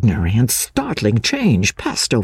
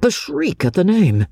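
usual speaking voice

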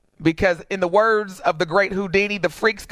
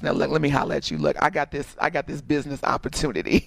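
sidle up and ask for money in a low hush; relative asking for money; 6 semitones lower than usual. Retold or imitated. Cousin sliding up at Thanksgiving to ask [name] for cash, low and sly. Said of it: "they show me like".

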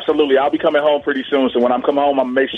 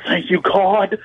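relieved thanks; 4 semitones higher, slower than usual. Retold or imitated. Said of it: "In the back of my mind".

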